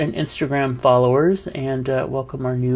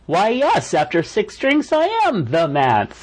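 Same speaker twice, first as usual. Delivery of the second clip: higher, hammed-up proud confession; mock grand reply; 7 semitones higher than usual. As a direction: play it like a boastful tipsy celebrity. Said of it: "And I'm all like".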